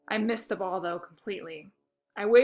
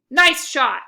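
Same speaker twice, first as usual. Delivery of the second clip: shouting; 5 semitones higher, 12 dB louder than usual. A narrator's reading. Coach praising from afar.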